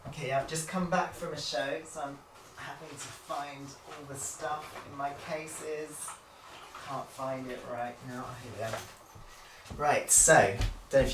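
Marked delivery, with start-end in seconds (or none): 0.1-8.8 distant, calling from the next room; from another room. Real, not acted. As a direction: shout it from down the hall, far off mic. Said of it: "I'm shouting from the other room".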